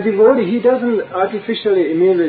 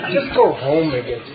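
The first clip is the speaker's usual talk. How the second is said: ordinary talk, nothing marked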